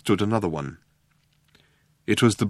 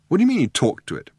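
high, fast, astonished question; can't believe what he just heard; 7 semitones higher, faster than usual. A narrator's reading. Ford, astonished, presses the robot, fast and high. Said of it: "exclaimed Ford".

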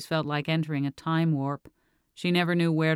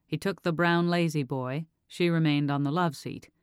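usual speaking voice

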